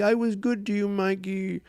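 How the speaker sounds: strained high-pitched pleading; pleading victim. Retold or imitated. Acts the drowning guard pleading.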